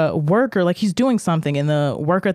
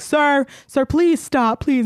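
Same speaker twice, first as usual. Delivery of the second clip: higher pleading calls of sir; staff pleading; 10 semitones higher, much slower than usual. Retold or imitated. ER staff begging the man to stop, higher and insistent. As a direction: beg him to stop, raised and insistent.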